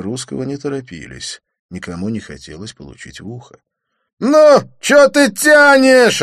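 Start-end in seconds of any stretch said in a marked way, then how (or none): 4.2-6.2 high, loud slow yell. A narrator's reading. The thug yells at Didier to fight. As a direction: yell impatiently. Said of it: "орал блондин".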